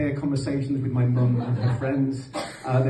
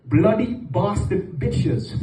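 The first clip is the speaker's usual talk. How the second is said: dad's catchphrase; 7 semitones higher, much slower than usual. Retold or imitated. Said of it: "That was his go-to phrase".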